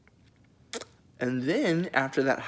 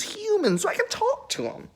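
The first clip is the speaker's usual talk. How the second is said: much higher, squeaky put-on voice for God; God's voice. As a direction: pitch way up into a silly falsetto God. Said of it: "And then he's like".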